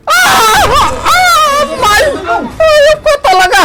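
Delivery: high, loud yelps of pain into a curse; pained cries and cursing. Acted. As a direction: cry out under the whip. Worker being whipped.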